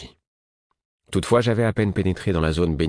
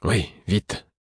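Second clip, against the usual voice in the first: weary answer; 3 semitones higher than usual. A narrator's reading. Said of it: "répondis-je accablé".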